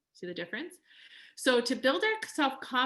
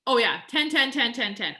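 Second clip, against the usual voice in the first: louder, higher eager voice, overselling the answer; eager mock shout; 3 semitones higher, 8 dB louder than usual. Quilting students shouting out their eager ten.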